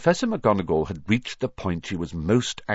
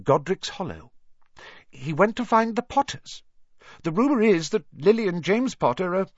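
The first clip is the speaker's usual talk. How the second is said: high, urgent, halting toward the end; pressing on; 11 semitones higher than usual. A narrator's reading. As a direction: break dreadful news, stumbling before the final word. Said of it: "she pressed on".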